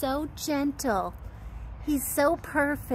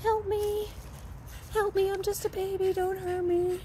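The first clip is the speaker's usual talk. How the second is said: pleading puppy voice; 7 semitones higher, 4 dB quieter than usual. Retold or imitated. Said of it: "Appeasement, appeasement".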